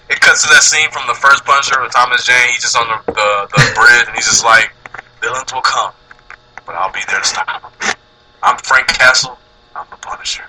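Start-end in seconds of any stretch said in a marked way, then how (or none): 5.2-10.4 low, solemn movie-hero declaration; lightly voiced, grave robot-leader speech; Optimus Prime voice. Playing Punisher as a noble hero for a co-host. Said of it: "Now it sounds like Optimus Prime".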